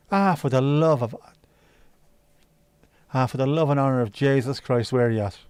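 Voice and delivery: exclaiming in a put-on Irish accent. Streamer with involuntary echoed accents, exclaiming in Irish accent. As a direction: let an Irish outburst slip out. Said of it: "Apologies to the Irish people".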